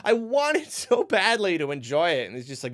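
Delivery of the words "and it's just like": ordinary talk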